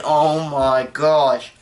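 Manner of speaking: drawn-out, awed oh my gosh; slow stunned gasp. Retold or imitated. Thinking while watching the movie, slow and stunned. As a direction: let it sink in slowly, stunned. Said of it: "And I'm thinking".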